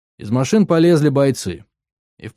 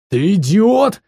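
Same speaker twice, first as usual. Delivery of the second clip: loud, high outraged roar; 9 semitones higher, 5 dB louder, slower than usual. A narrator's reading. Mercenary leader at the man with the detonator, roaring. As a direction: explode in disbelief, pitch jumping up. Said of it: "взревел глава наёмников".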